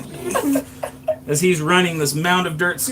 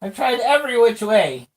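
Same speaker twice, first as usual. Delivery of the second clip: put-on high squeaky cursed old man voice; 10 semitones higher, 4 dB louder than usual. GM plays Daryl in character.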